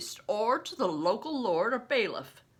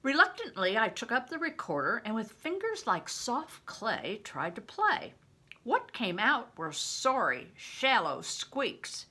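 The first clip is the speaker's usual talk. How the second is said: ordinary delivery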